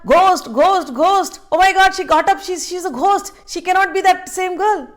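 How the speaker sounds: loud, high panicked shouting of ghost; terrified crowd. Retold or imitated. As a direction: shriek it like villagers seeing the dead rise. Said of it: "the people's reaction are".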